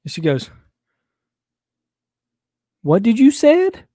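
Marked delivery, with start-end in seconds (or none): 2.8-3.8 high, loud, rising demand; mock-serious little girl. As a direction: imitate an indignant child, pitch climbing. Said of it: "this real serious look on her face".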